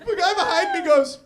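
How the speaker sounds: normal talking voice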